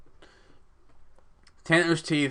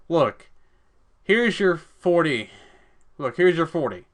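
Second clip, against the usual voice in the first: higher, louder mock gift; taunting offer; 4 semitones higher, 5 dB louder than usual. [name] taunting Charlie with a forty. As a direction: dangle the bottle at him.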